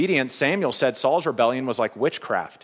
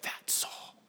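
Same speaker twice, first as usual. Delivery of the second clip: hushed, voiceless mock shock; barely audible acted whisper; stunned whisper; 18 dB quieter than usual. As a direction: whisper it as an awed realization. Witch's shocked realization, acted.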